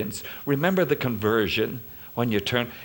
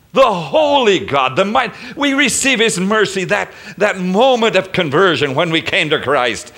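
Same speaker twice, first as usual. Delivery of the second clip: full-force preaching; 6 semitones higher, 7 dB louder than usual. Acted. Pastor proclaims God's mercy to congregation, full preaching force. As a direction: lift it to a preaching peak.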